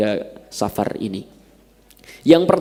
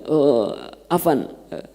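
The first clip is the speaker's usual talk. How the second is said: slow careful Arabic; slower than usual. Retold or imitated. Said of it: "Ya, kata saya".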